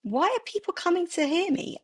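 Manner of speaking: high-pitched, wondering question; imitated bewilderment, pitched right up; bemused. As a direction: quote someone honestly baffled, voice lifted high. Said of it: "like, bemused at this enormous gathering".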